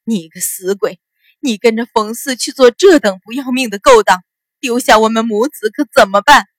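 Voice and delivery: loud, tearful berating that builds; wife wailing and cursing her kneeling husband; wailing scolding. A narrator's reading. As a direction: scream at your husband through tears. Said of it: "一边大声骂道".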